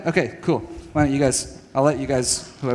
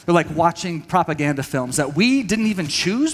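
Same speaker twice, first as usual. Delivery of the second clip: normal talking voice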